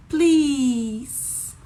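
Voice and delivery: loud, high, drawn-out please; exaggerated imploring plea; acted pleading. Shown as a demonstration. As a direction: beg with a long pleeease. Acting out words with mime.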